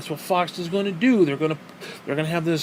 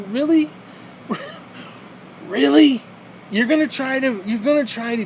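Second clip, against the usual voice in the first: really can't believe it; shrieking disbelief at the idea; incredulous; 7 semitones higher than usual. Real, not acted. Incredulous rant.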